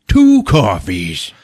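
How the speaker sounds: slow, heavy deadpan order; deadpan cop. Retold or imitated. Cop ordering from the waitress, slow deadpan. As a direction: drag it out, dead serious. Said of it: "he goes".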